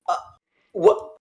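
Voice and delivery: confused stammer climbing to a high "Huh?"; baffled disbelief. Retold or imitated. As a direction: sputter like the floor just dropped. Stunned after being grabbed.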